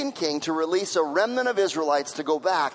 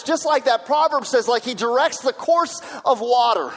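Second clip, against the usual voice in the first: louder preaching, rising to a shout; preaching at full force; 2 semitones higher, 4 dB louder than usual. Acted. Pastor urges the congregation to pray for leaders, preaching louder and louder. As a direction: build the call to prayer like a revival preacher.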